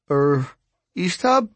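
hesitant, rising squeak on the name. A narrator's reading. Narrator timidly interrupts Ishtab, voice squeaking. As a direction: squeak it out as a nervous question. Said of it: "My voice squeaked".

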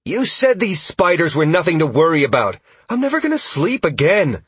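high-pitched distressed accusation; shouting complaint. A narrator's reading. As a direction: wail the blame over a fight. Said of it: "Sierra joined in shouting".

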